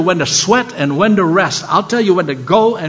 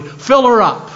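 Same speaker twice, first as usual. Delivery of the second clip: proudly; 6 semitones higher, slower than usual. Retold or imitated. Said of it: "I said proudly".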